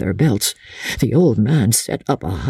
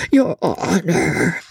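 high, drawn-out whimpering plea; quavering old man's entreaty; imploring; 6 semitones higher, much slower than usual. A narrator's reading. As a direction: beg for mercy, nearly crying. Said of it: "he ventured imploringly".

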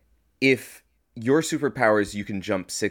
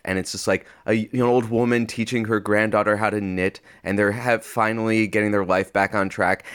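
normal talking voice

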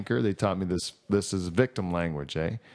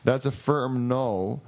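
slow, firm; 2 semitones higher, much slower than usual. Real, not acted. Emphatic verdict.

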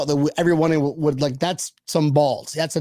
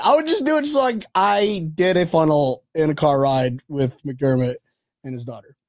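ordinary talk, nothing marked

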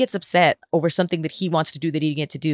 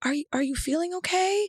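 higher, softer voice of a worried small son; gentle little boy; 12 semitones higher, 7 dB quieter than usual. Retold or imitated. Son gently checking on his mom lying in the dark, higher and soft. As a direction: ask gently, like a concerned child checking on mom. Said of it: "I was like".

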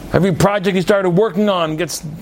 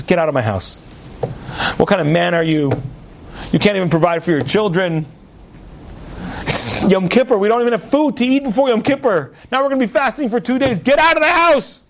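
losing it, acted; 2 semitones higher than usual. Wife throws husband out before Yom Kippur, acted anger rising. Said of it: "And she just loses".

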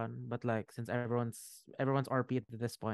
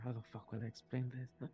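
muttered much softer, not fully whispered; under his breath; 9 dB quieter than usual. Acted. Dragon man to himself on how to explain this, under his breath. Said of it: "you just hear him whisper".

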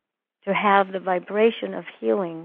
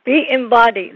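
louder repeated healer's plea; 3 semitones higher, 7 dB louder than usual. Retold or imitated. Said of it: "She's like".

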